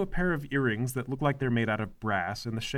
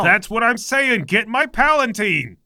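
bossy mother voice; 6 semitones higher, 6 dB louder, slower than usual. Acted. Mother demanding her palanquin.

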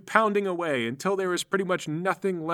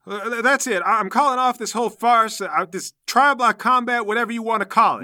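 high, loud, stammering protest; flustered outburst; 6 semitones higher, 7 dB louder than usual. Acted. Game master's character calls off the combat, flustered outburst. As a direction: put a loud stop to the whole thing. Voicing character calling off the fight.

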